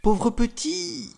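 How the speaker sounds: a mother fretting for her lost son; worried mother. Acted. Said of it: "se disait-elle". (mère inquiète, lente et plaintive)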